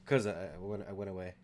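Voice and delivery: distant and quiet, off mic; far away. Real, not acted. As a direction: voice from across the room. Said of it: "Sound like you ran away".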